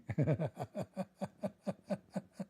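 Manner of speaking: high, stuttering giggle; long, wheezy trailing laugh. Real, not acted. Comedian laughing at his own French joke, high giggle.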